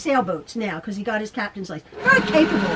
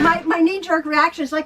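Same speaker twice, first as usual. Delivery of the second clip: ordinary talk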